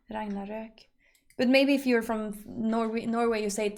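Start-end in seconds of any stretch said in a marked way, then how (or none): 0.1-0.7 Ragnarök said softly with an ö ending; quiet, low RAGNARÖK the Swedish way; Nordic pronunciation. Shown as a demonstration. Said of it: "the Nordic way of saying it".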